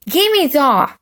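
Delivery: a touch of a grabbing yell; Henry snatching the diary, half-shouted; mild mock scream. A narrator's reading. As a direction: demand it, lunging. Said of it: "screamed Horrid Henry".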